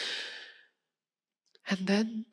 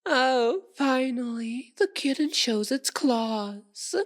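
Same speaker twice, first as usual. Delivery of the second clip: mocking delight; 6 semitones higher, slower than usual. Vampire as kitten shows claws, mocking delight. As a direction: crow at the prey's defiance.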